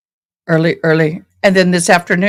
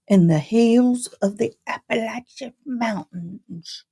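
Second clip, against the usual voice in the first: ordinary delivery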